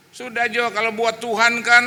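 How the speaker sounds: put-on loud, high indignant old lady; imitated irate church granny; angry retort. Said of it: "dia marah".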